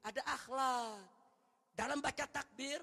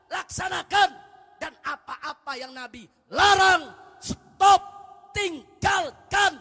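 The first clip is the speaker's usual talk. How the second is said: louder and higher, climbing to shouted commands; building fiery preaching; 5 semitones higher, 16 dB louder than usual. Acted. Preacher urging the faithful to obey the Prophet, building to a shout. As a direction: build each command harder until the climax.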